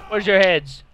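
ordinary talk

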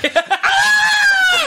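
high, loud scream; comic long yell of someone falling; tumbling scream. Acted. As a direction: scream all the way down.

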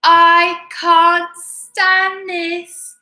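very slow, high, loud wail; exasperated bear. A narrator's reading. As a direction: stretch out the bear's despairing protest. Bear refrain again.

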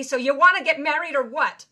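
put-on a boyfriend screaming a proposal from the roadside; retold scream. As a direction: shout the proposal like a tantrum. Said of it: "screams at me".

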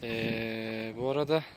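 tired, hoarse voice; hoarse from fasting and fatigue. Real, not acted. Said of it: "sesim boğuk gelebilir".

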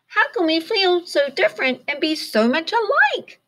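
high, puzzled little bird; wondering. A narrator's reading. Pip wondering about friendship, high curious voice. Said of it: "wondered Pip".

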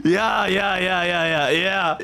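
rapid-fire German-style "ja"; mock angry German. Replicating the angry language, mock angry German. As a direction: imitate an angry German, lightly. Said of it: "Germans just sound angry all the time".

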